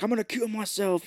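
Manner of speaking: high melodramatic line; a lighter ham wail; mild mock threat. Host repeats rooftop man's threat, high and hammed. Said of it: "he's like".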